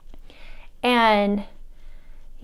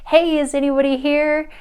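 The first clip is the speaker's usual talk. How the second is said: slightly raised, higher call; 5 semitones higher, 4 dB louder than usual. Retold or imitated. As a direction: call out to see who's there. Calling out to a tent.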